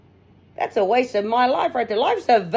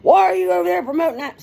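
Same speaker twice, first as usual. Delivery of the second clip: higher, louder accusing question; higher and louder, pointed and suspicious; 9 semitones higher, 4 dB louder than usual. Shown as a demonstration. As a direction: throw it out as an accusation. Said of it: "I could have took that as".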